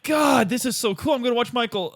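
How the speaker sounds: high, giddy fan voice; mock fan excitement. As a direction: gush like a kid seeing his hero. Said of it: "It was like".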